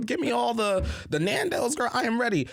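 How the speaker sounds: high, hyped-up party voice; excited. Retold or imitated. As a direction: gush about turning up in Manchester.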